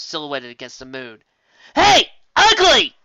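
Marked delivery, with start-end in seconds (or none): 1.7-3.0 very high, loud, slow taunt; taunting shout. Acted. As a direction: bait the monster to look up. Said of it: "shouted at Ursa".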